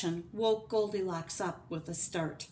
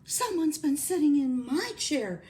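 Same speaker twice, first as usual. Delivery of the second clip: higher, louder middle-sized bear voice; Mama Bear; 9 semitones higher, 7 dB louder than usual. Acted. Mama Bear finds her chair used, middle-sized voice. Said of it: "her mellow middle-sized voice".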